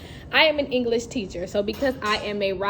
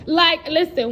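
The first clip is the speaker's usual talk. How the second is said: laughing; 6 semitones higher, 5 dB louder than usual. Real, not acted. Laughing at her own story.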